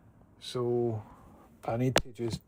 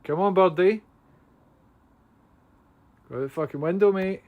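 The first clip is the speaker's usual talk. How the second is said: high, loud urging call; coaxing a wild bird; 6 semitones higher, 8 dB louder than usual. Real, not acted. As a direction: urge the bird out, calling up.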